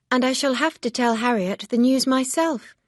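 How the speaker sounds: a hint of a firm young lady; Emma, lightly voiced, reproachful. A narrator's reading. Emma's thoughts voiced.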